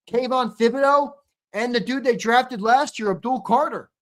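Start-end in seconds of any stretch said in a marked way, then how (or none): none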